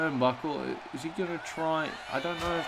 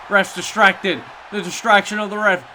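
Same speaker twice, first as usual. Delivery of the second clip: repeats it louder and higher; loud call; 7 semitones higher, 9 dB louder than usual. Acted. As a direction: punch the repeat with excitement. Repeats call, second time louder.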